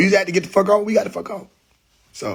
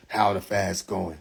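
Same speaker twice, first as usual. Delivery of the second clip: low, slow, softer question; mimicked question; 8 semitones lower, 7 dB quieter, slower than usual. Viewer asking about his fast, mock low question. Quoting question put to him.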